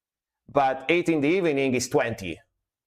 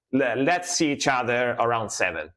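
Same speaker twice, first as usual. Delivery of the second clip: normal talking voice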